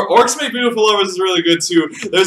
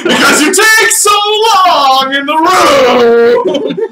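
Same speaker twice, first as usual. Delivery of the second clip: exasperated yell; 10 semitones higher, 4 dB louder than usual. Real, not acted. DM exasperated at slow players.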